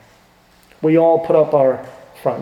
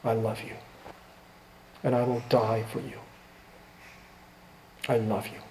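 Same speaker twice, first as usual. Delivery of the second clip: much softer and lower, hushed and tender; soft lowered voice of Jesus speaking love; tender whisper of Jesus; 5 semitones lower, 13 dB quieter than usual. Retold or imitated. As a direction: barely breathe the words like a gentle promise. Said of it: "And still he says".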